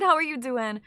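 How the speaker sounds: usual speaking voice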